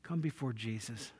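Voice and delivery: soft, hushed pastor's prayer; closing prayer. Acted. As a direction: pray quietly and humbly over the church.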